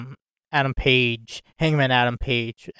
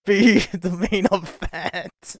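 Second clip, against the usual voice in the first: laughing; 5 semitones higher, slower than usual. Real, not acted. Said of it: "I can't stop laughing".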